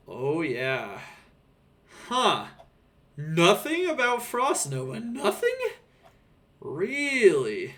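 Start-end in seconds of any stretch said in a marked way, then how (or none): 2.1-7.7 surprised. Real, not acted. Surprised at missing nerf.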